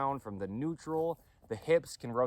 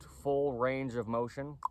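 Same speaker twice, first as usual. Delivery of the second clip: "full range of motion" set apart slowly as a quote; slow quoted phrase; 3 semitones higher, slower than usual. Shown as a demonstration. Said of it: "quote, unquote".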